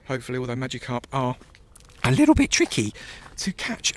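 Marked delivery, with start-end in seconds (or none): 2.0-3.9 high squeak. Acted. Squeaky word emphasis.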